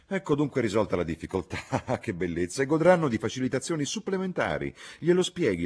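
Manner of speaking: gleeful, rising excitement; delighted. Acted. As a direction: exult with a chuckle. Relieved landlord rejoices at solution.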